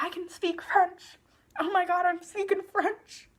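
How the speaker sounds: high, gushing imitation of a delighted teen; hammed-up oblivious glee; mock excitement. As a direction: squeal it like it's the best news ever. Acting Anna thrilled about her French.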